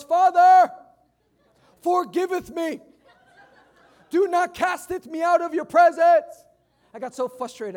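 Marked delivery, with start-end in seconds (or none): 0.0-6.4 higher, much louder hammed-up prayer with laughter; mock King James plea. Son on his knees begs his father, hammed-up King James pleading. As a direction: ham up a melodramatic begging in old English. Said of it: "and he goes".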